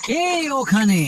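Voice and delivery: a loud, high, scared shout into the dark; frightened call. Acted. Fisherman shouts at the unseen thief in the dark, frightened. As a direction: call out to an unseen intruder, afraid.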